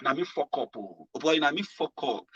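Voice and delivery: quiet self-blame after a shock; rueful self-talk. Pretend. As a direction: admit your mistake under your breath. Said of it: "begin to tell yourself where you fucked up".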